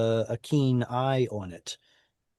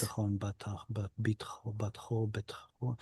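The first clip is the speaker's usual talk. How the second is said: very soft, tentative run of word forms; under the breath; 2 semitones lower, 12 dB quieter than usual. Real, not acted. Teacher tries out possible forms, murmured to self. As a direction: murmur to yourself while working it out.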